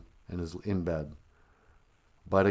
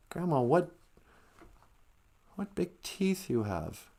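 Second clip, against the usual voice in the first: put-on wary little girl; 8 semitones higher, 5 dB quieter than usual. Red asks about teeth.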